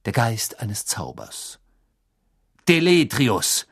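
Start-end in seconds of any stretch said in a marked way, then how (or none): 2.7-3.7 gerufen. A narrator's reading. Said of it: "rief Mister Diggory".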